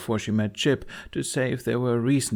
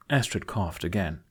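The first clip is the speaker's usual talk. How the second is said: usual speaking voice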